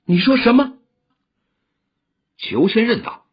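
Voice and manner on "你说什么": high, fierce demand; sharp shouted demand. A narrator's reading. Woman grips the old man's wrist, shouting a fierce demand. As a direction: seize him with the question, pitched high. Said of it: "只喝问".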